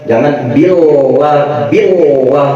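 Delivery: ordinary talk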